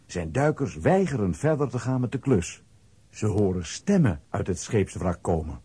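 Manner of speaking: ordinary talk, nothing marked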